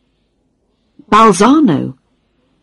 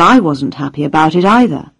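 ordinary delivery, nothing marked

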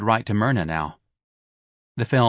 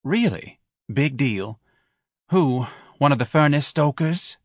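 Mrs Levy's voice; 4 semitones higher than usual. Acted. Wife sniping at her husband who skipped the races. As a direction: needle him with every word.

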